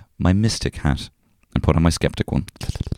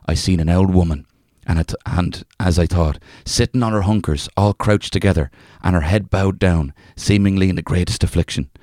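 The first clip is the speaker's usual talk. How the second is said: reading a folk tale in a lightly put-on Irish accent. Reading an old folk tale to a co-host, in a light Irish accent. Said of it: "can I just say that accent".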